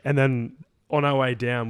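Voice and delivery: ordinary talk, nothing marked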